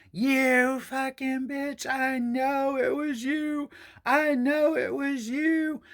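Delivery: put-on high, slow drunken wail; slurred high drunken accusing; drunk accusation. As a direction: drag it out like a sloppy drunk. Drunk neighbour yelling accusation.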